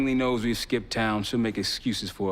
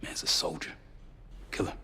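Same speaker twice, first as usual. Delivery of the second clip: whispered, much softer, like sharing a secret; hushed confidence; 3 semitones lower, 10 dB quieter than usual. Acted. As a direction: lean in and whisper it. Confiding about a dangerous man.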